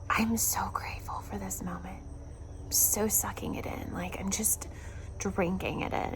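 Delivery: soft awed whisper; whispered gratitude. Real, not acted. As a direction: breathe it out, drinking in the moment. Quiet moment watching the sunset.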